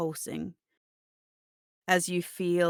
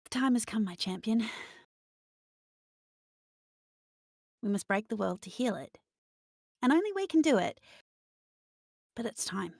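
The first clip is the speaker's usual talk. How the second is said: higher-pitched motherly entity, calling a champion to action; ominous yet comforting, raised in pitch; dark maternal voice; 4 semitones higher, much faster than usual. Acted. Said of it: "dark, deep voice. Comforting. Almost m-, maternal".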